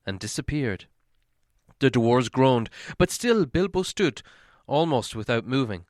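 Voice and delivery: ordinary delivery, nothing marked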